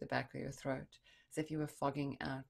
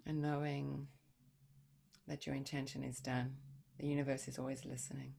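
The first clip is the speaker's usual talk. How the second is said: guided meditation. Acted. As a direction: ease them into stillness.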